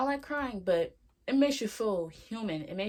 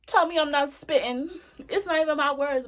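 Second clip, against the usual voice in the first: loud, high, excited boast; hyped up; 8 semitones higher, 8 dB louder than usual. Real, not acted. Host hyping favorite quote, loud and excited. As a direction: crow about it at full energy.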